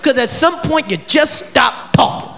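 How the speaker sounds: ordinary talk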